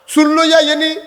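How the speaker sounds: high, loud rhetorical question; emphatic questioning. Real, not acted. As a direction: demand an answer from the congregation. Presses congregation with rhetorical question.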